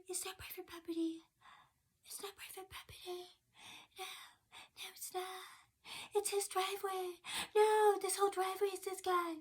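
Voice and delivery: very soft, high, half-whispered droning mimicry; mock her in a tiny high monotone; mocking dim officer. Mimicking the officer arguing about the driveway, mocking. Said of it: "she just sounds so dumb and uneducated".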